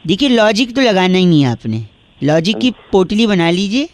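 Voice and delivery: ordinary delivery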